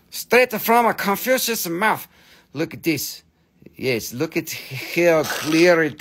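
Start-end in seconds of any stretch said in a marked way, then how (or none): 0.1-2.1 higher-pitched put-on Chinese accent; hammed-up sage in fake Chinese accent; mock Chinese accent. Seller repeats the Confucius joke, mock Chinese accent. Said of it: "This is straight from Confucius' mouth".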